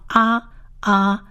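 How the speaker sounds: "啊" held as a long open ah; long "ah" vowel. Shown as a demonstration. Teacher showing listeners the Hebrew vowel, long "啊". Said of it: "发长啊".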